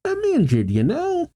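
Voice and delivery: high-pitched mock whine; acted complaint. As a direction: protest in a high cartoon character voice.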